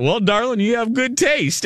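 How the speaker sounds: high, far-off mock Texan charm; playful celebrity impression, high and breezy; imitating Larry Hagman. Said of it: "and he called her darling".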